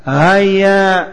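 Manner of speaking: normal talking voice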